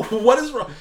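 high, loud incredulous cry; exasperated disbelief. Real, not acted. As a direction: cry out in disbelief at a dumb choice. Exasperated at dumb choice.

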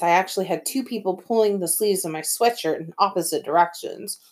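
usual speaking voice

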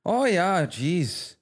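put-on Minnesotan accent, swooping down from high; hammed-up Midwest accent; Fargo accent. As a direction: react like a mildly concerned Fargo local. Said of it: "that Fargo accent is a lot of fun".

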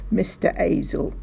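Hazel said as Azel, no H; dropped H. A narrator's reading. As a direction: drop the H in Hazel, Mister Azel. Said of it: "take away the H".

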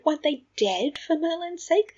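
high-pitched, indignant question; exasperated disbelief. A narrator's reading. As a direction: push the pitch up in protest. Sirius inwardly ranting in disbelief.

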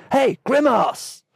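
high, slow Aussie call over a loudspeaker; Australian accent. Retold or imitated. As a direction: shout it like an Aussie lifeguard. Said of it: "The lifeguard's voice relayed through the loudspeakers".

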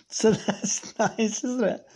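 laughing through the words, up high; amused giggle while reading. Real, not acted. Amused by the note.

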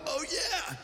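high, delighted oh yeah with a laugh; a hammed-up thrilled cheer; mock excitement. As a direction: gush like an impressed fan. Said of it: "we're all like".

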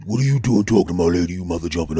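sharp, fast angry challenge. Retold or imitated. Jealous boyfriend snapping at a rival. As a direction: confront him like a jealous boyfriend.

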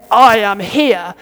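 a frightening heavenly father announcing himself; booming angry God. Acted. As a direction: boom it down like a stern headmaster God.

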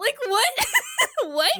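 laughing shout of disbelief. Real, not acted. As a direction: burst out in shocked laughter. Shocked laughing outburst.

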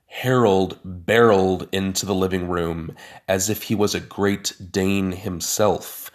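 usual speaking voice

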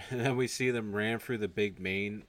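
usual speaking voice

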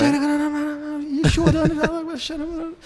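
high, stretched-out mock wail; imitated wailing. Recalling crying at the altar. (choro imitado, agudo e arrastado)

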